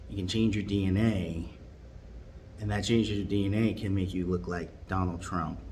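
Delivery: usual speaking voice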